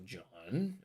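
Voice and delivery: JOHN drawn out, sliding up; lengthened, rising JOHN. Shown as a demonstration. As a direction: turn "John" into a question. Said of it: "goes up at the end".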